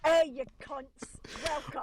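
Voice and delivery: hammed-up British hello; mock British accent. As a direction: lay on a cheeky British accent.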